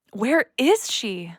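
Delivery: ordinary delivery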